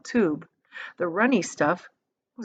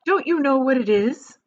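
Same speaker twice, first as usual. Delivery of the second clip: higher, louder teasing question; proud challenge; 6 semitones higher, 5 dB louder than usual. A narrator's reading. Wonka quizzing the visitors, proud and teasing. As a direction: dare them to guess, delighted. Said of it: "gazing proudly".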